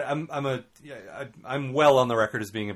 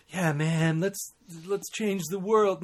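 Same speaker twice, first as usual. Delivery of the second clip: cheesy hippie; 8 semitones higher, 3 dB louder, much slower than usual. Retold or imitated. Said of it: "not in a cheesy hippie way".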